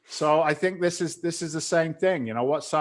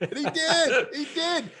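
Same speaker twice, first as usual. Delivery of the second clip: high-pitched gleeful punchline; squeaky, delighted disbelief; amazed. Real, not acted. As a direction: crow it in amazement, voice way up. Punchline after his disbelief, amid laughter.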